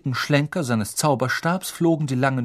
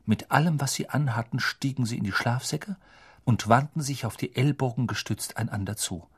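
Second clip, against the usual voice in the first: ordinary delivery, nothing marked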